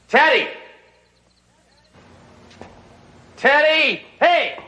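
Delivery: loud high call across the distance. Real, not acted. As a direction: shout his name to find him. Searching, calling for Teddy.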